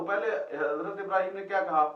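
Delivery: ordinary talk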